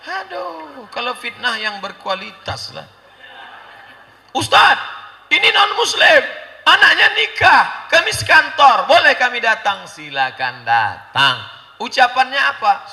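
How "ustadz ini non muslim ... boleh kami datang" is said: eager guest asking. Acted. Coworker asking the ustadz to attend, high and loud. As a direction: ask the ustadz brightly if you may come.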